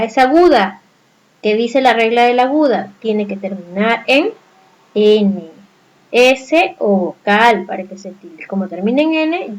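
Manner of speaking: ordinary talk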